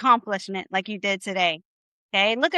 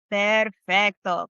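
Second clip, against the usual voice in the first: Perfecto drawn out slowly, each syllable given time; slow model word; 4 semitones lower, much slower than usual. Shown as a demonstration. Teacher models Perfecto slowly for the class. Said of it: "teacher models Spanish word".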